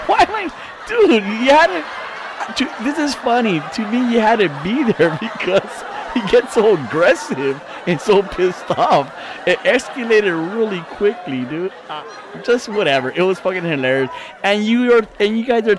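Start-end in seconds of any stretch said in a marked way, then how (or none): 0.1-11.4 high, giggly words through laughter; trying to talk while cracking up; laughing while talking. Real, not acted.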